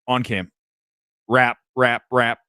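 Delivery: urgent countdown in the earpiece; producer cue. Retold or imitated. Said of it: "the producer's telling him in his ear".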